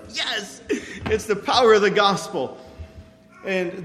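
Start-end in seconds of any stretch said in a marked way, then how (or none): 0.1-2.6 joyful exclamation. Retold or imitated. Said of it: "I was like".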